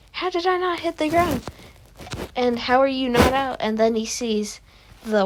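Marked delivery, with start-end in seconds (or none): 0.1-3.6 high, incredulous mock voice; imitated disbelief. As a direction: act out baffled outrage, pitched up. Retold quote of angry character.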